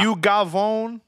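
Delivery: teasing the guest as a goomba, in a mock New York accent; mock New York Italian accent. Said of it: "turn it back on".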